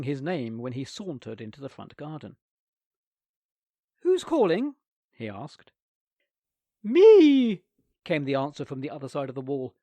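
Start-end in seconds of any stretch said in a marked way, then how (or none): 4.0-4.7 put-on high, bright boy asking; a boy calling back innocently; young boy voice. A narrator's reading. Nicholas answering his aunt's calls, higher boy voice. 6.8-7.6 loud, high cry from the tank; aunt calling out. A narrator's reading. Trapped aunt calling to Nicholas, loud high cry.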